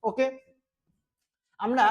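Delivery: ordinary delivery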